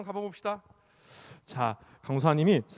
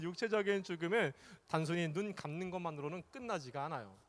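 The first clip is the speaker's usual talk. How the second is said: ordinary talk, nothing marked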